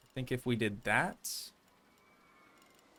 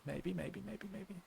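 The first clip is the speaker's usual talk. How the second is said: "maybe" repeated, softer each time until near silent; fading away; 5 semitones higher, 13 dB quieter than usual. Real, not acted. Streamer trailing off teasingly to chat, fading to nothing. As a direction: let each maybe fade out.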